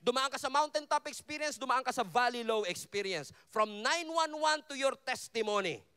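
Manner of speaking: loud, ringing sermon voice; fired-up Filipino pastor's declaration; preaching at full force. Acted. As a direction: preach it out to the congregation.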